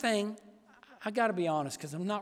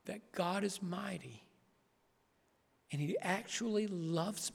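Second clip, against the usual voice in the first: hushed reverent preaching; 7 dB quieter, slower than usual. Acted.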